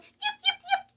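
very high, loud little fox yip. Acted. Little fox calls out to the party, high yipping. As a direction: yap like a tiny fox calling out. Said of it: "She called".

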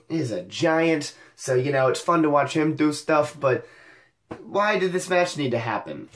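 normal talking voice

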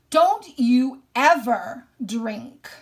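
loud, slow, fierce mother's order; stern command. Acted. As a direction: bark it as a furious mother, drawn out. Mother commands son never drink.

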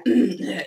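loud throat clear. Real, not acted. Sam clearing her sore throat for the viewers, loud throat clear. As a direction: clear the croak out hard.

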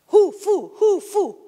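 loud, high "hu, fu, hu, fu" alternation; pronunciation drill. Shown as a demonstration. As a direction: drill "hu" against "fu", each syllable punched out. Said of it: "la única cosa que hace es te pone".

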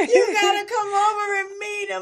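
high, loud tearful cry of the line; crying shout. Retold or imitated. Girl telling boyfriend to meet her dad, crying loudly. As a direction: wail it through tears. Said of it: "And I was like".

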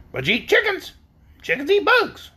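high, cackly hen voice; high-pitched hen comeback; cackling hen retort. A narrator's reading. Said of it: "retorted the yellow hen with an odd cackle".

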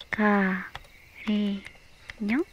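slow, drawn-out whisper. Acted. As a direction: breathe the word close and slow. Sneaking up on him.

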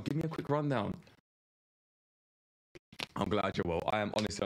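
ordinary talk

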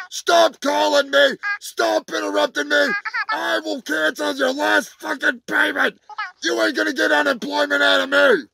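furious shouting rant; asshole voice. Acted. Comedian yelling threats at his manager over the phone. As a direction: bark threats down the line. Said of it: "I have to use my asshole voice".

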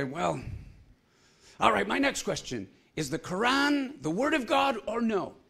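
high, loud, pushy question; loud challenge. Retold or imitated. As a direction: press him hard, loud and high. Challenger presses second question.

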